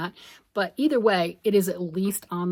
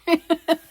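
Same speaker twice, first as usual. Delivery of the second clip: bright burst of laughter, higher and louder; short laugh; 9 semitones higher, 6 dB louder than usual. Real, not acted. Vlogger laughing at her own cheekiness, a quick bright laugh. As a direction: laugh at your own confession.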